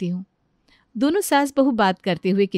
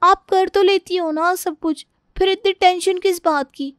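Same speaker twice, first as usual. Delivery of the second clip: nonchalant youngster; 10 semitones higher, 3 dB louder than usual. Acted. Youngster asks mother why she is so tense, high-voiced nonchalance. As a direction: dismiss mother's worry in a high-pitched voice.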